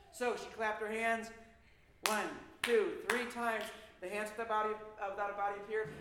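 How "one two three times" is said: plain reading voice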